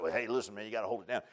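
low, soft imitation of a shusher; discreet hushing. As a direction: quietly talk someone down. Imitates someone hushing the crier.

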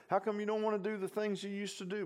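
high, put-on voice of an old party buddy; mocking worldly friend. Preacher voicing a puzzled former party friend, high mock voice.

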